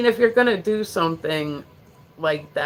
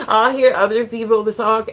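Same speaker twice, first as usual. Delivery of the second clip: high laughing words, louder; 6 semitones higher, 7 dB louder than usual. Real, not acted. Streamer laughs at her own Jersey accent, talking through it.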